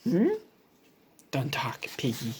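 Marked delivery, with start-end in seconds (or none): 1.3-2.3 hushed. Acted. Gerald hushing Piggie.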